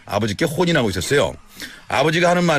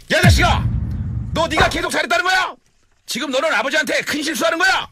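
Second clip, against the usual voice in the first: sudden outburst; 12 semitones higher than usual. Retold or imitated. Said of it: "버럭 이렇게 얘기했습니다".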